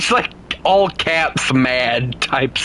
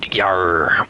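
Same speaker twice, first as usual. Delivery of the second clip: pirate voice; 8 semitones lower than usual. Pretend. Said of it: "Why do I sound like a pirate now".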